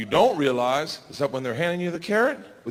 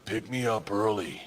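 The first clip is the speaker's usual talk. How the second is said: soft, low little plea; half-whispered plea in dad's ear; mild mock whisper; retold whisper; 7 semitones lower, 6 dB quieter than usual. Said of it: "whispers in my ear".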